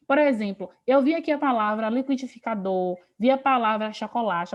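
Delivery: ordinary talk, nothing marked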